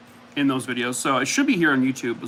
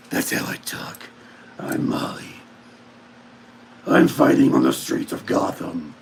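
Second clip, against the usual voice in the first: low whispered superhero voice; Molly's Batman voice; 8 semitones lower than usual. Pretend. Voicing the dog as a crimefighter: low whispered Batman growl. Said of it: "That's how she sounds".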